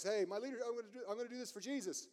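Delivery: high, hurried mock eagerness; eager volunteer. Church member offering strengths to leader, eager volunteer. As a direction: gush it like a keen helper.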